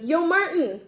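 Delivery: loud, high call across the pool; half-done call from a poolside. Acted. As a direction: shout his name across the water. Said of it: "Okay, I'm not doing voices".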